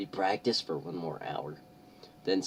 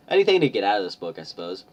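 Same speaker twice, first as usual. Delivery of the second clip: snarky quip; 8 dB louder, faster than usual. Real, not acted. Snarky comment.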